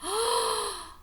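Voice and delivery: startled gasp of discovery. Acted. Teacher to class on spotting the wig, gasp. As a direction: gasp like you just found the clue.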